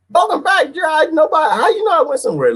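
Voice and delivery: very high-pitched put-on protest; high, comic outburst; mock indignation. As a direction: squeal a quick mock objection. Acts surprised reply to welcome back.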